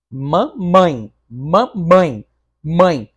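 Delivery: "mamãe" split ma-mãe, final vowels together; "mamãe" in loud separate syllables, ending on a joined nasal diphthong; syllable demonstration. Shown as a demonstration. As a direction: sound out "mamãe", then isolate "mãe" as one glide. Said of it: "não sai junto?".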